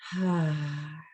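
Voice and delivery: breathy sigh out through the mouth; release sigh. Shown as a demonstration. Teacher showing the class how to release the breath, sighing. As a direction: let the held breath go. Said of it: "let it out".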